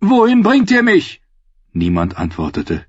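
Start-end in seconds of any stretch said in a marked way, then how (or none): 0.0-1.2 very high, loud frightened plea; fearful question. A narrator's reading. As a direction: demand to know in rising panic. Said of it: "fragte Brienne".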